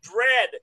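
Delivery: "Dredd" punched loud on its own; stress on Dredd. Real, not acted. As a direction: hit "Dredd" hard and alone. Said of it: "Not Judge Dredd".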